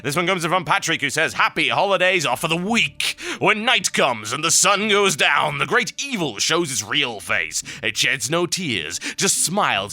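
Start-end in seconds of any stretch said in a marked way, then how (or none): 1.3-9.9 slow, high, ominous storyteller voice; put-on sinister accent. Host reading Patrick's evil postcard, slow menacing accent. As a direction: draw the menace out, sinking at the end. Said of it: "might not say so in that accent".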